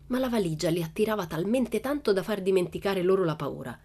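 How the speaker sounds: plain reading voice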